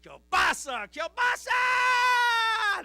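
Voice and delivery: loud cry rising to a very high, drawn-out scream; mock despairing scream. Polish worker jumping off building over kielbasa, hammed-up scream. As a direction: shout it, then stretch it into a comic falling-man howl.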